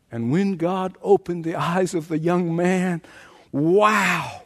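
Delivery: ordinary talk, nothing marked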